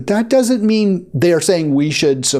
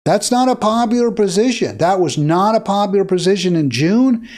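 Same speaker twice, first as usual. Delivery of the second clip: ordinary talk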